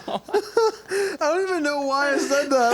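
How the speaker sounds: real laughter, high squeezed words; talking through laughter. Real, not acted. Explaining a silly question to his friend, laughing helplessly.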